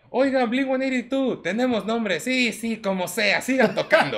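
high, loud call to a band; imitating someone shouting over to musicians; retold shout. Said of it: "les grita que".

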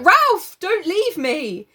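squeaky high, slow mock plea; pleading Piggy. Piggy begging Ralph not to leave him, high squeak. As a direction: wail it like a scared boy. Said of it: "So he's like".

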